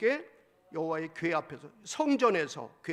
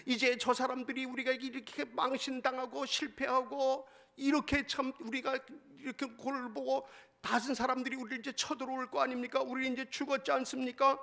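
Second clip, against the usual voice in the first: mock weeping plea; 6 semitones higher than usual. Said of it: "막 울면서 그러는 거예요".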